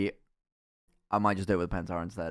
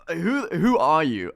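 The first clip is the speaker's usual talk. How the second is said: slow, high, loud disbelief; incredulous; 6 semitones higher, 9 dB louder, slower than usual. Retold or imitated. Student asking the strange lecturer, incredulous. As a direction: ask it in stunned disbelief.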